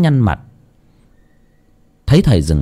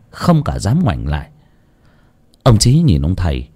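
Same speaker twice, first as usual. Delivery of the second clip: ordinary delivery, nothing marked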